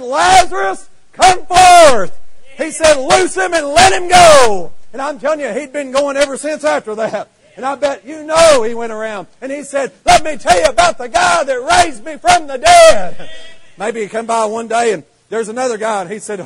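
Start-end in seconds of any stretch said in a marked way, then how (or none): 0.0-4.7 much higher, loud, slow command into the tomb; commanding call. Acted. Jesus calls Lazarus from tomb. 10.0-13.3 high and loud eager testimony; testifying shout. Acted. Raised man testifies.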